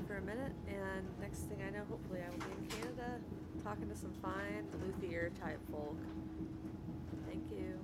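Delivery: keeping it down on a train; murmured close to the mic; hushed voice. Real, not acted. Keeps voice down on the train.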